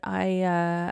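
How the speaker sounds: ordinary delivery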